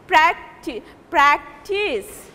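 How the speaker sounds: "practice" split, its second syllable held longer; second-syllable stress. Shown as a demonstration. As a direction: take time on the end syllable of "practice". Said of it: "pronouncing the second syllable".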